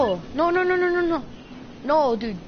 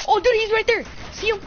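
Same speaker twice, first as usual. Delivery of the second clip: panicking gamer; 7 semitones higher, 3 dB louder, faster than usual. Real, not acted. Enemy close, rushed teammate callouts.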